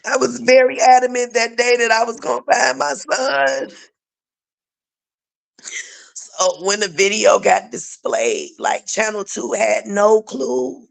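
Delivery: high, strained voice through tears; pushing words out while crying; tearful. Real, not acted. Still grieving, voice strained high.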